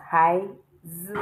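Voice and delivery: "hai z" with z held level and long; wrong first tone. Shown as a demonstration. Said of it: "because there is no first tone here".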